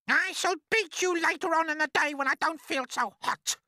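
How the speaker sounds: high, loud, furious scolding; cruel, threatening aunt. Acted. Aunt promising the boy a beating, shrill and menacing. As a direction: threaten the child in a high, harsh voice.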